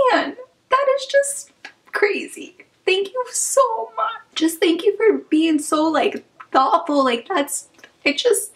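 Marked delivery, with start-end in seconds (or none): 0.1-7.0 high, choked-up laughing thanks; laughing through tears of gratitude; overwhelmed. Real, not acted. Thanking a viewer for the gift, high and choked up.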